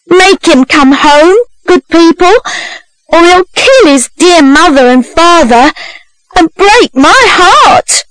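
high, theatrical appeal; pleading. A narrator's reading. Young woman appealing to bystanders, high pleading. False sister begs the onlookers.

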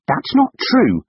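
very high, loud contradiction; shouted protest; angry shout. A narrator's reading. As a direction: cut in with a fierce denial. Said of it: "shouted Tessa".

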